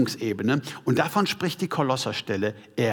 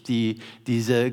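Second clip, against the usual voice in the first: ordinary delivery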